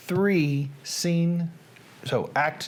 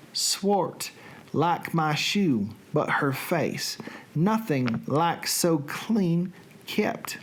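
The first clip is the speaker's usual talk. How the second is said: slow, theatrical Southern reading; Southern accent; much slower than usual. Acted. Reading Shakespeare aloud for a livestream, in a Southern accent. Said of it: "with a Southern Accent".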